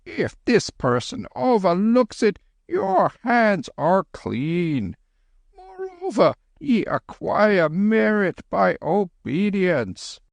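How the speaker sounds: high, slow calling; slow high worried old monk; anxious Lama. Acted. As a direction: call out gently in the dark. Lama counselling patience.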